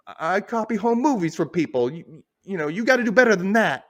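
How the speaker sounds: hammed-up retort from a movie crook; James Cagney style. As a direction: imitate a defiant gangster under questioning. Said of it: "he starts getting more like that too".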